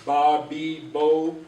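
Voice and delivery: Ba, be, bo with long drawn vowels; long vowel syllables. Shown as a demonstration. Teacher showing long vowels to students, drawn out. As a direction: hold each vowel long. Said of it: "these are the long vowels".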